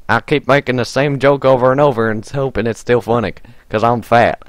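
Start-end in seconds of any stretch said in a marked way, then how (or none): none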